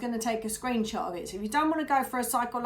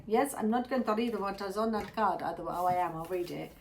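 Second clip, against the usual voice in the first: ordinary talk, nothing marked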